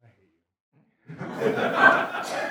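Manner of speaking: ordinary delivery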